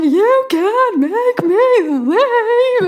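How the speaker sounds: very high, very slow sobbing protest; crying refusal to leave; wailing protest. Retold or imitated. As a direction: plead through a wail, stretching each word. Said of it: "And I'm like".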